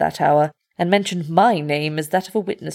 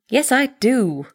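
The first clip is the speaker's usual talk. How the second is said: a touch too forceful, higher and insistent; over-emphatic; 6 semitones higher than usual. Acted. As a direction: overstate it, as if trying to convince himself. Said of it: "in the over-emphatic voice".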